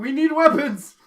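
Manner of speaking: high, loud panicked cry; mock scream. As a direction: yell it like a scared teen. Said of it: "They're screaming".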